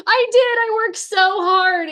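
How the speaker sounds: high, loud retold protest, dragged at the end; mock exasperated protest. Wife defending her effort to laughing husband, loud mock exasperation. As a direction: protest loudly with comic exasperation.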